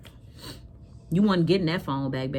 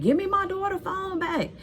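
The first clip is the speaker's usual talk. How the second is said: high-pitched retold yell down the street; hammed-up yell at the man; 13 semitones higher than usual. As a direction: shout after him as he runs off with the phone. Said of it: "While I'm yelling down the street".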